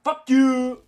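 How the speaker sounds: high, very loud drawn-out yell; furious shout. Real, not acted. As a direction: belt the insult out at an opponent. Insulting another player in game.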